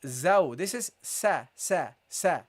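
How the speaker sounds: light sa syllables; teacher drills the letter seen; Arabic seen. Shown as a demonstration. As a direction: sound out sa, sa, sa. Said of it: "The seen has a different sound".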